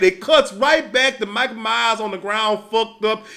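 ordinary talk, nothing marked

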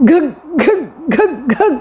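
gag on the words, too scared to speak; a small boy running in, too frightened to get words out; terrified stammer. Acted. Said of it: "آتے ہی چیخ مار کر پلنگ پر چڑھ گیا اور بولا".

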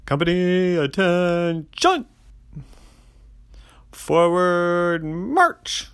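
high, loud drill-sergeant bark; loud strong voice. Acted. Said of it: "a loud, strong voice".

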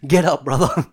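loud, exasperated holler; acted sideline urging; mock teammate yell. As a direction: shout it at a struggling teammate. Acted teammate at footy training.

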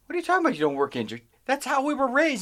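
high-pitched, disbelieving comeback; incredulous protest. Retold or imitated. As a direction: act out a baffled son protesting. Retold protest to his dad.

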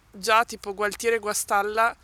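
ordinary delivery

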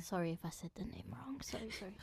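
whispered apology; whispering. Real, not acted. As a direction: hush it as an aside.

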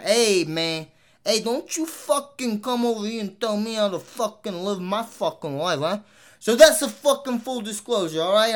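mock street threat in a thick New York accent. Telling listeners not to lecture him, in a loud mock New York accent. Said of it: "end it off in this fucking accent".

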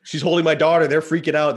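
reliving the terror of the scene; alarmed. Real, not acted. Wife crying, kids disappearing.

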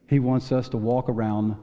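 normal talking voice

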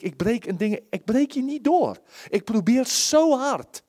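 preacher voicing his own frustration, straining; frustrated strain. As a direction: push against being stuck. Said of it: "dat ik denk".

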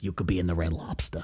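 low, soft mock warning with an accent; instructor catchphrase. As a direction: deliver the catchphrase as a lightly imitated instructor. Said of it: "he would say".

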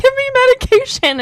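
suffering dental pain begging for medication, hammed-up; desperate plea. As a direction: whine for meds. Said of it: "legitimately was like".

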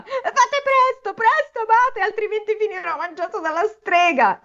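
high, desperate yelling at the door; panicked high cry for help; frantic novice shout. A narrator's reading. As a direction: scream for the abbot to hurry. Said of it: "Il novizio gridava".

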